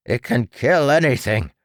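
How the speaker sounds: threatening doctor. A narrator's reading. The doctor threatens his creation, menacing.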